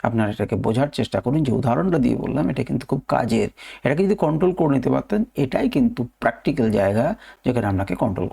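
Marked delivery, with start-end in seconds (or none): none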